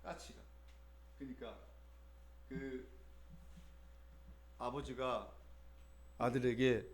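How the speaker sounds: ordinary talk, nothing marked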